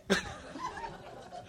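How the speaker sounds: very high squeal of laughter; laughing. Real, not acted. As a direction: burst out laughing.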